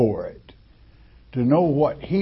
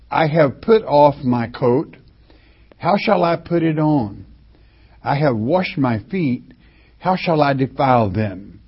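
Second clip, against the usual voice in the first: raised, ringing Bible reading; scripture reading; 4 dB louder than usual. Acted. Preacher reads the beloved's words to the congregation, raised and declaiming. Reading the girl's verse aloud.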